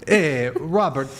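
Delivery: drawn-out Jamaican hey; slow mock Jamaican greeting. Streamer greeting a gifter in mock Jamaican accent. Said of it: "like I'm Jamaican".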